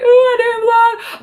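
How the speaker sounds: very high, loud, drawn-out whine; high-pitched comic excuse; exaggerated self-quote. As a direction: stretch it out in a squeaky mock protest. Exaggerates own sheepish reply to followers.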